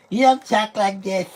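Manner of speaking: mock an actor's put-on nerd voice; nerdy voice impression. Mocking the actor Josh's nerd voice, high loud mock nerd. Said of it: "It's like he's doing a voice of a nerd".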